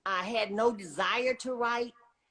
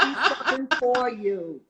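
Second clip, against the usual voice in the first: laughing tease; 4 semitones higher, 5 dB louder than usual. Real, not acted. Proud grandma joking, laughing.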